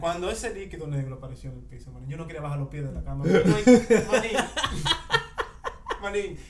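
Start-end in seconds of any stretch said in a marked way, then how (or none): 3.2-6.4 laughing. Real, not acted. Laughing recalling the fear.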